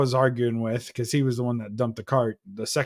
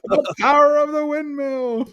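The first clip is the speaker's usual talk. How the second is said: hammed-up windmill cry; 12 semitones higher than usual. Mocking the windmill, laughing.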